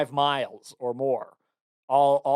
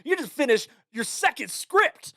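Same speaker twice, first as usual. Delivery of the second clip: higher, hyped-up pep talk, like a personal trainer; pep talk coach; 8 semitones higher than usual. Retold or imitated. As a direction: pump yourself up like a coach cheering. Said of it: "you sound like a personal trainer".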